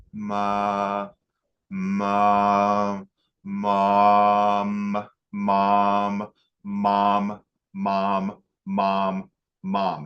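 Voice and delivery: long, level "maaa... mom" on one note; imitating a halting, droned first word; sustained repeated cry. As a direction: draw each "mom" out evenly, over and over. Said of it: "over and over as he's kneeling".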